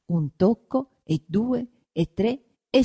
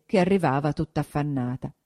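ordinary delivery, nothing marked

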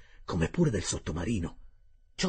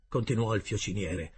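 plain reading voice

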